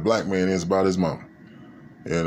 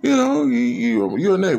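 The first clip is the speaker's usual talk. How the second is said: much higher, warm-hearted neighbor voice; neighbor refusing money; 14 semitones higher than usual. Retold or imitated. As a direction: wave off the money in a high friendly voice.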